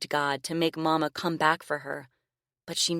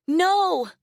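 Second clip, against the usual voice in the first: loud, high panicked scream; 7 dB louder than usual. Acted. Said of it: "she screamed".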